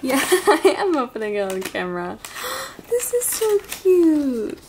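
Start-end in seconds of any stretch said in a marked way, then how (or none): 2.2-2.8 gasp. Real, not acted. Opening a surprise gift. 2.9-4.7 high, drawn-out gushing; slow, high "so cute" squeal; delighted squeal. Real, not acted. Delighted by gift.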